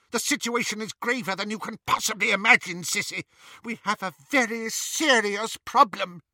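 high-pitched, agitated warning; urgent agitation. A narrator's reading. Bellatrix warning her sister of grave trouble, high urgency. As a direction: press the danger, voice high.

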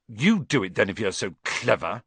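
ordinary delivery

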